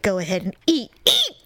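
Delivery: retold mad muttering. Woman silently goading the rude patron as she eats, mad. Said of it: "I was just so mad".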